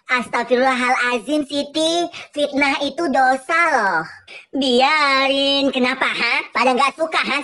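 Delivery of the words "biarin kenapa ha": normal talking voice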